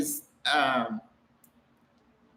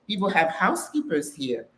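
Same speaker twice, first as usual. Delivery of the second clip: usual speaking voice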